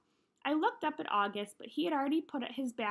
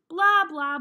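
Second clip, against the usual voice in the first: loud, punched blah blah like a droning teacher; mock Charlie Brown teacher drone, loud; 5 semitones higher, 11 dB louder than usual. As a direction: bark the blahs out as a mock teacher. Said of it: "the way the grown-ups talk in Charlie Brown movies".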